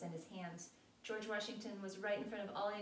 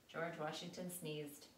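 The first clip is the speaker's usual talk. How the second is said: ordinary delivery, nothing marked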